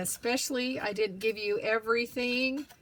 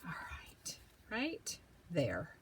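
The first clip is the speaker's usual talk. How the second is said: whispering; 4 semitones lower, 8 dB quieter than usual. Real, not acted. Crafting narration, hushed.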